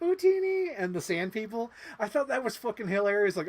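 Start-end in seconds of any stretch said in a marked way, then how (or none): 0.0-0.7 very high, loud squeaky Jawa. Retold or imitated. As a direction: squeak it out like a tiny desert creature. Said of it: "the little Jawas going".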